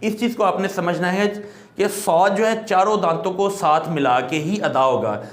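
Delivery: ordinary delivery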